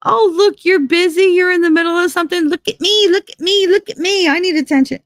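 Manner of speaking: high whiny cat voice begging for attention; mock needy cats. Mocking her cats as clingy needy pets, high whine. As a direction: whine like a pushy pet.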